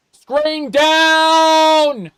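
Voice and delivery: screaming high and very loud; terrified shout. Acted. Gamer yelling as blindness blacks out the screen, shout. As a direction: yell it out in mock panic.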